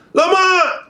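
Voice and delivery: high-pitched mock yell of a mother calling her son; mother's shrill imitated call; mom yelling name. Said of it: "moms is yelling his name".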